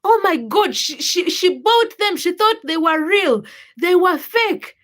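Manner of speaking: giddy as if you pulled off a prank; excited kids. Retold or imitated. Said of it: "we are just like".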